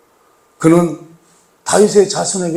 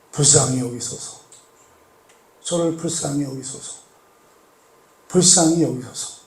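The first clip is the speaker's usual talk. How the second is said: pleading prayer; 3 semitones lower, 9 dB quieter than usual. Acted. Beggar's plea for mercy acted.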